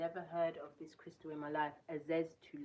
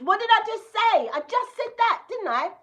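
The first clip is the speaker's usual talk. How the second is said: much higher and louder, exclaiming in disbelief; startled shout; 19 dB louder than usual. Real, not acted. Reacting to the card text she just read, startled shout. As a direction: shriek at what you just read.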